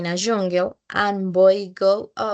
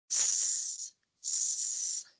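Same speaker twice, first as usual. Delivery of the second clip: very quiet voiceless s held out; soft s hiss; 12 semitones lower, 11 dB quieter than usual. Shown as a demonstration. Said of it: "I will show each phonetic symbol".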